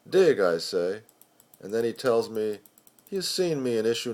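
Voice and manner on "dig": sharp, loud one-word reply; emphatic "Dig". Acted. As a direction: toss it back, louder. Poet answers the editor.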